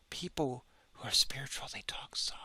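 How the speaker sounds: breathy mock-spiritual whisper; mocking soft whisper. Mocking gentle gurus, in a hushed whisper. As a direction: whisper it like a hushed holy man. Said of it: "how he talks so soft".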